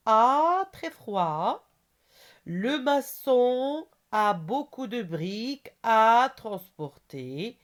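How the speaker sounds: very slow, high, loud reading with stretched words; teacher's drawn-out, projected read-aloud; slow exercise dictation. Shown as a demonstration. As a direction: dictate it word by word, holding the gaps open. Reads exercise sentences aloud.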